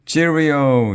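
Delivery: high, loud British singout; chirpy British lady's greeting; happy British accent. A narrator's reading. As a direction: call it out in a cheery British accent. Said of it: "she said in a happy British accent".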